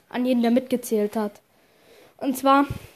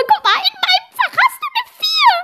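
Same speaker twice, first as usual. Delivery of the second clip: much higher, squeaky brag; 3 dB louder than usual. Acted. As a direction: squeak it out proudly. Teacher brags in high voice.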